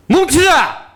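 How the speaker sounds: high ringing rally shout; shouted slogan. Real, not acted. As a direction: lead the crowd in a slogan. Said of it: "다 같이 구호를 외치겠습니다".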